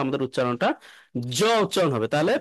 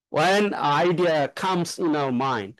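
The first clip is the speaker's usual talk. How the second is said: very slowly, word by word; slow model reading; 2 semitones lower, much slower than usual. Shown as a demonstration. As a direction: read it out slowly for the class. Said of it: "আমরা কিন্তু comes বলি".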